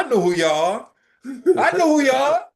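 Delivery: laughing, building up the repeat; imitating a thrilled stranger; excited repeat. Retelling man's excited recognition.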